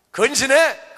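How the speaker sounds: loud, high barked order; sudden mock-stern outburst; stern scolding. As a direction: scold like an angry father handing down punishment. Acted father scolding returned son.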